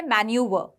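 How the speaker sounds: मैन्यूवर with the final r dropped, man-yoo-vuh; British pronunciation. Shown as a demonstration. Teacher models British मैन्यूवर for students. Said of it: "ये British pronunciation है".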